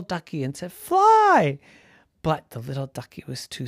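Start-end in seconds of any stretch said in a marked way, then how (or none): 0.7-1.6 very high, loud cry; urgent command. A narrator's reading. Ducky frees the duckling and urges escape, shouting. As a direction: send it off with one big shout.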